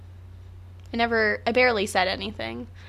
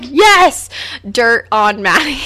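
imagined gleeful listeners; 7 dB louder, much slower than usual. Pretend. Host voicing listeners gloating over her secret, mock glee. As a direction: crow it like listeners catching gossip. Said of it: "You're probably like".

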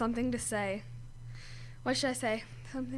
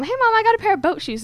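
higher, louder and fast, retelling her own excitement; excited; 9 semitones higher, 9 dB louder, faster than usual. Retold or imitated. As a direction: burst out with the news to mom. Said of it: "I told my mom".

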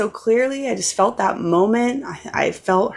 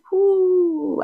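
high exultant whoop; joyful whoo; 11 semitones higher than usual. Real, not acted. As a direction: let out a praise-filled whoo. Praise exclamation about God.

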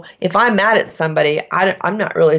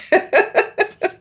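high giggle after the joke; amused high-pitched chuckle; laugh; 12 semitones higher than usual. Real, not acted. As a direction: burst into a short high laugh. Amused by her own imitation.

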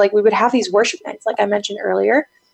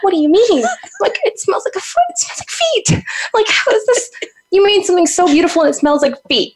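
very high, incredulous complaint; retold exasperation, pitched way up; baffled protest; 10 semitones higher than usual. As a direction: squeal it in comic disbelief.

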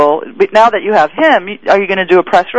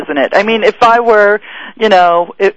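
ordinary talk

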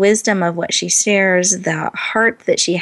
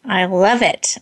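ordinary talk

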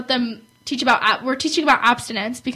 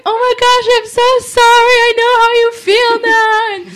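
high, loud, sing-song sorry; now-sick mom apologizing to daughter, high sing-song; mock apologetic mom; 10 semitones higher, 8 dB louder than usual. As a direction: coo it back like a suddenly sympathetic mother. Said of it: "and she's like".

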